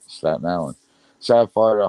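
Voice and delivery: usual speaking voice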